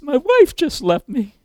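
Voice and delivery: hammed-up weepy complaint; mock crying. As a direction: imitate a man pretending to cry. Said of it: "he's pretending to cry".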